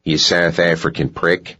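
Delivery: mocking attempt at a South African accent; bad South African accent. A narrator's reading. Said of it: "put on a bad imitation of Sinclair's accent".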